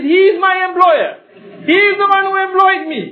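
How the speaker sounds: shouting, pointing out the boss; loud accusation. Retold or imitated. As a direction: yell out who hired you. Man exposes the buyer.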